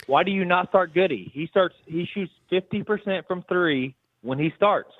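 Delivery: nearly shouting it, fed up; exasperated. Real, not acted. Caller repeating complaint, more heated.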